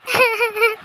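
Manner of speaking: high giggle; teasing laughter. Acted. Child teases sibling while denying laughter.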